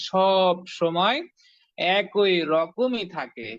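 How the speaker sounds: each word drawn out slowly for emphasis; teacher stressing সব সময় with a slow drawl. Shown as a demonstration. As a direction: lean on সব সময় and spell out একই রকমই slowly. Stressing letter never changes form.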